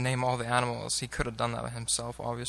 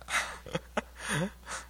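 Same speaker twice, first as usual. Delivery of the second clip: breathy laugh; 8 semitones higher, 5 dB quieter than usual. Real, not acted. Laughs after awkward joke.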